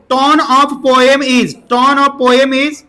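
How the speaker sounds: very slow, word by word; slow dictation. Shown as a demonstration. Teacher dictating the question to his students, very slowly. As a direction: stretch out every word of the question.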